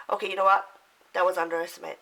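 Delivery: ordinary talk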